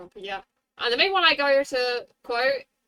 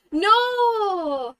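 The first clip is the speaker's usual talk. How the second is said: dramatic Texan no, higher and louder; fake Texas accent; 8 semitones higher, 6 dB louder than usual. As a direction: howl it like a hammy cowboy. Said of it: "how to say no in a Texas accent".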